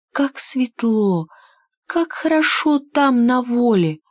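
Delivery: put-on slow, high, longing girl; dreamy little girl. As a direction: sigh it out dreamily. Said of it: "думала девочка".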